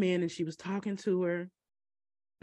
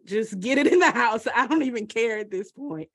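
exasperated; 3 semitones higher, 6 dB louder than usual. Retold or imitated. Exasperated reply to helper.

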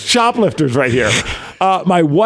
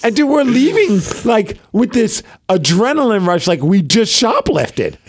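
excited retelling; 6 semitones higher than usual. Real, not acted. Adrenaline rush retold.

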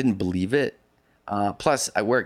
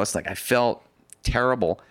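usual speaking voice

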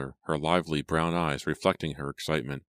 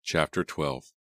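ordinary delivery

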